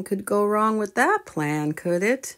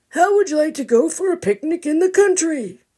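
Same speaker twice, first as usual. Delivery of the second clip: cheerful grandpa; 11 semitones higher, 5 dB louder than usual. Acted. Grandpa proposes picnic.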